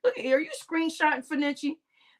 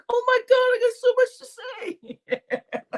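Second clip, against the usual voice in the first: high, slow, laughing overwhelmed voice; mock flustered Egon; 12 semitones higher than usual. Egon watching the playback, laughing high flustered voice. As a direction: gush like he's bursting to talk. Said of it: "he's gonna say".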